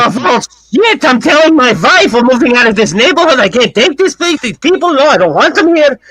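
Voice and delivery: put-on high, panicky old man fleeing the neighborhood; shocked old man. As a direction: squeal it out, horrified by the new neighbors.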